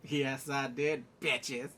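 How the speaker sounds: slow, smug put-on admission; Aemon. Boy owning up to the insult, slow and unbothered. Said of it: "freaking Aemon was like".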